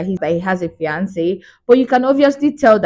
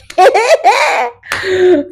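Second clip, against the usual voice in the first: high laugh; 11 semitones higher, 3 dB louder than usual. Real, not acted. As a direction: burst of high-pitched laughter. Laughing at the scene.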